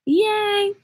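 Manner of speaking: a squeaky high cheer; high yay. Real, not acted. Cheering for a nervous viewer, high and excited. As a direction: cheer along happily.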